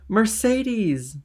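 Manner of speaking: high, loud joyful shout; elated calling out; joyous call. A narrator's reading. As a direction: call the name happily from outdoors. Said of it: "cried a voice joyously outside the house".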